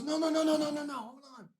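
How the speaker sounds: high, loud rapid no's; retold panicked no's. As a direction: grab it back, imitating the panic. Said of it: "And he goes".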